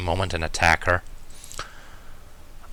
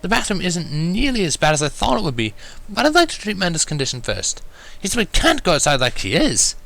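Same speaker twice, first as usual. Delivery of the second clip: lady Rarity; 9 semitones higher than usual. A narrator's reading. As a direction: ask with polite elegance. Rarity voiced in story.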